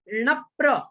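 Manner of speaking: णप्र as one unit, "nap-pra"; joined conjunct. Shown as a demonstration. Teacher repeating the model for pupils, णप्र joined as nap-pra. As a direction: lean ण into the doubled प of णप्र. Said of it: "अलग अलग नहीं करना है".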